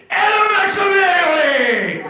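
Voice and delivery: sudden high, loud hammed-up yell; mock yell. Roasting a contestant, mimicking his sudden yelling. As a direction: yell it out like the guy losing it.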